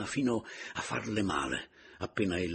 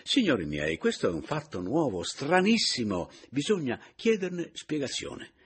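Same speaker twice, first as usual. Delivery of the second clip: loud, climbing appeal to the company; animated old spiritist, voice raised and rising; exclaiming; 8 semitones higher, 7 dB louder than usual. A narrator's reading. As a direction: declaim it with mounting amazement. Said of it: "esclamò Paleari".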